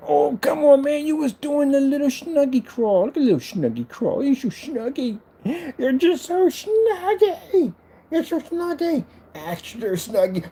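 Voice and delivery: very high, drawn-out pet voice; cutesy, high cooing. Real, not acted. Baby-talking to a snuggly pet.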